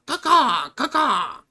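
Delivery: high cawing like a crow. Pretend. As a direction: caw like a crow for no reason. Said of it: "I don't know why I'm doing that".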